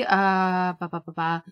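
normal talking voice

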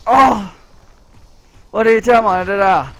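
loud, high irritated groan of annoyance; real exasperated yell; annoyed outburst. Real, not acted. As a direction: vent your irritation loudly. Gamer annoyed by enemy.

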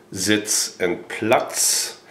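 Sitz and Platz slowly, each word set apart; German Sitz and Platz. Shown as a demonstration. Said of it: "make a difference between".

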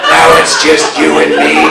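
low put-on monotone, squaring up; mock tough-guy threat, dropping very low; heckler's challenge. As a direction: imitate the heckler in a low drone. Angry old heckler confronts him.

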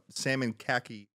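usual speaking voice

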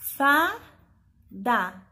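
Fá bem alto, dá mais grave; syllables spelled out. Shown as a demonstration. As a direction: separar Fá e dá com ênfase. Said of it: "Vamos separar as sílabas?". (sílabas bem marcadas)

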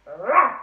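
loud mock dog bark; fierce bark. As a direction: bark like an angry dog at the gate. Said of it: "Timmy began to bark fiercely".

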